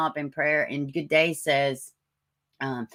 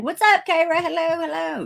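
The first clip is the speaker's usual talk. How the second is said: high sing-out hello, loud at first; high-pitched greeting; 12 semitones higher, 4 dB louder than usual. Real, not acted. Streamer welcomes a viewer to the chat, high greeting.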